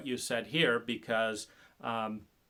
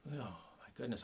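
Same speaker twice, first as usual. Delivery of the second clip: normal talking voice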